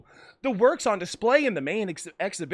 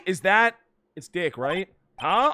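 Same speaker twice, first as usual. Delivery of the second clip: ordinary talk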